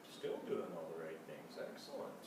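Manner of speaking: a soft, low aside to the listeners; hushed aside. Real, not acted. Reader leaning out of the story to murmur to the audience. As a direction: drop away from the story and murmur.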